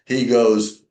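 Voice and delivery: ordinary talk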